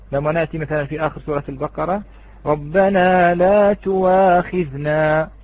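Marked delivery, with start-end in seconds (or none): 2.5-5.3 تواخذنا recited slowly and loudly with a clear w sound in place of the hamza; Quranic recitation with waw. Shown as a demonstration. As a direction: chant it slowly, saying تواخذنا with waw and no glottal stop. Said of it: "يقرأها بالواو لا يقرأها بالهمزة".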